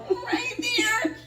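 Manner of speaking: high-pitched mock whimper; frightened squeak. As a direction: squeak it out in acted fear. Scared pointing at snake, retold.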